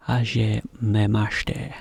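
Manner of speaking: "Hajimemashite" drawn out slowly, part by part; careful, slow model of "Hajimemashite"; slowed-down demonstration. Shown as a demonstration. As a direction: say "Hajimemashite" at half speed for learners. Teacher breaks down tricky Japanese phrase.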